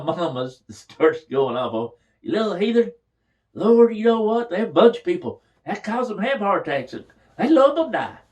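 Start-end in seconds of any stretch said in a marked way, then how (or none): 2.2-8.2 high, loud scolding shriek; scolding mother. Retold or imitated. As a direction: tear into him like an outraged mom.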